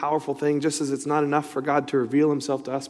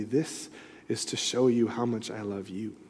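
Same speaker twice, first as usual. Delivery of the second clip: the Lord speaking; 3 semitones lower, 8 dB quieter than usual. Retold or imitated. The Lord's voice in prayer.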